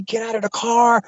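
retold yell without full volume; mock yelling. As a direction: taunt and threaten as the raging husband. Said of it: "he's yelling at me".

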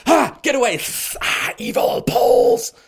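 loud, hammed-up recoiling cry; mock horrified shriek. Greeks repelling visiting Polish privatizers, mock horrified shriek. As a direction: hiss and shout as if warding off devils. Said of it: "the Greeks were like".

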